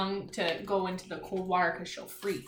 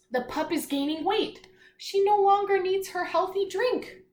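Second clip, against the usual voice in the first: lightly storybook read-aloud; reading aloud to children; 3 semitones higher, 6 dB louder than usual. Acted. Teacher reading a seal story to children, storybook voice. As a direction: read the page clearly to the class. Reads picture book aloud.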